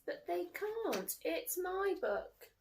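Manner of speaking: put-on slow, high pleading child voice; high, slow sulky child; upset little girl. A narrator's reading. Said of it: "Lola says".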